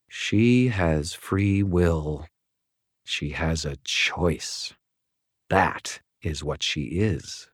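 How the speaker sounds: slow, soft, poisonous bitterness; quiet and bitter. A narrator's reading. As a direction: let it seep out slow and venomous. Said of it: "quiet and poisonously bitter".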